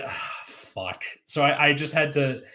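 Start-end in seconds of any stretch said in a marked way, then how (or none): 0.0-1.0 whispered, softer swear under the breath; breathy low resigned curse; muttered curse. Retold or imitated. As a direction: groan it quietly to yourself. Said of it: "So I'm just like".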